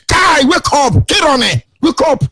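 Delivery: high, slow call to wake up. Acted. Preacher calling a sleeper to wake up, high and slow. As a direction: rouse a sleeper, high and drawn out.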